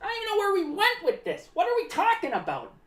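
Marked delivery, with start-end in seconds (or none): none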